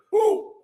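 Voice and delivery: high joyful whoop; excited whoop. Real, not acted. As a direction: let out a big cheer. Hyping the crowd, big cheer.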